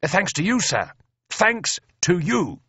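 high, effusive congratulation; beaming. A narrator's reading. Officer pumping his friend's hand in praise, beaming.

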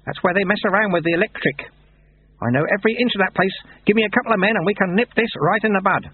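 high-pitched eager appeal; hint of pleading. A narrator's reading. Lutze asking abbot for a couple of men, pleading. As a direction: appeal keenly, with a touch of pleading. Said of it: "A hint of pleading in his voice".

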